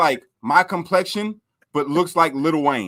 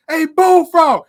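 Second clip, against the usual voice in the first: loud, high call; yelling; 11 semitones higher, 10 dB louder, much slower than usual. Retold or imitated. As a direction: shout it out the window. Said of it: "we can yell out the window".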